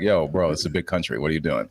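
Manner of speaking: a Brit's idea of an American; mock American accent. Mocking British actors faking American, low mock American accent. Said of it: "British actors doing American accents".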